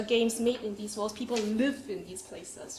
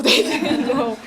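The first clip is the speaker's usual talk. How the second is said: laughing; 12 dB louder, slower than usual. Real, not acted. Laughing at audience response.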